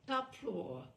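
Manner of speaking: ordinary delivery, nothing marked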